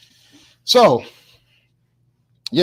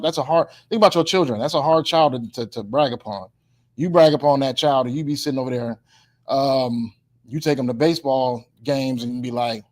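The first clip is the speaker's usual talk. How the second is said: ordinary delivery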